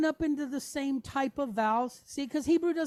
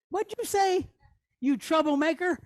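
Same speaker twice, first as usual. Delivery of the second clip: higher-pitched playful challenge; mock scolding; 6 semitones higher, 4 dB louder than usual. Teacher teasing a student who spoke up, mock scolding. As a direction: playfully call out the class troublemaker.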